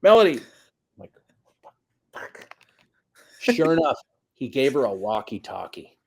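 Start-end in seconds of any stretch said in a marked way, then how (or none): 0.0-0.3 loud call across a field, pitched up; calling out a name. Retold or imitated. Dad calling his daughter from the sideline, loud call. 2.1-2.5 a sharp whispered swear under the breath; whispered curse. Retold or imitated. Coach seeing the walkie-talkie, whispered curse.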